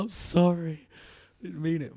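high, loud mock apology; put-on voice. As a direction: say it in a silly high voice. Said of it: "My voice".

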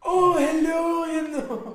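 very high, loud, drawn-out mock Scottish; Scottish accent. Answering co-host's request, loud high Scottish accent. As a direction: screech a put-on Scottish greeting. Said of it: "How about Scottish?".

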